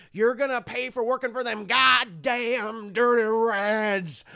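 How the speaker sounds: slow threat swelling to a loud finish; hammed-up threat. Sherman threatening Andy in a headlock, hammed-up threat. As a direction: drag out and punch the last words.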